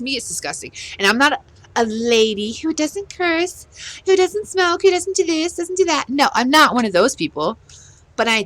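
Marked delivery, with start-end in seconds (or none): 2.5-6.1 high, sing-song list; mock prim listing. Speaker denying being a perfect lady, mock prim. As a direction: rattle off the virtues in a high, mocking voice.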